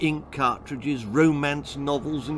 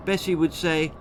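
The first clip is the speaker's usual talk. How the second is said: usual speaking voice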